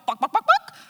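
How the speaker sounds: high, loud chicken cluck; comic hen noise, high and loud. Retold or imitated. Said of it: "she'd pick her up her head and go".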